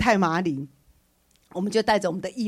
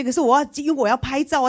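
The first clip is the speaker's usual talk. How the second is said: ordinary talk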